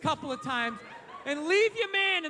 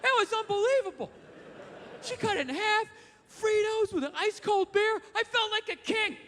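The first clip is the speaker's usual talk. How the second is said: high, dreamy musing to himself; a man alone in his car, dreamily recalling the sandwich; fond reminiscing; 8 semitones higher than usual. Retold or imitated. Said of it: "myself talking in the car".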